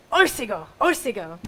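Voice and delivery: punchy, called-out encouragement; spirited cheer. Shown as a demonstration. Teacher showing how to give the singer spirit, punchy cheer. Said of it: "giving you spirit".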